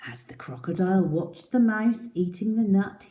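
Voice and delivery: ordinary delivery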